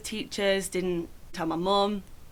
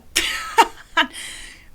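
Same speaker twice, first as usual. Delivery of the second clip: high, loud laugh; loud burst of laughter; 10 semitones higher, 8 dB louder than usual. Real, not acted. Laughing after saying yeah.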